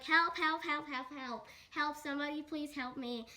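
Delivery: loud, rapid cries for help, easing off; screaming for help. Acted. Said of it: "He's, like, screaming for help".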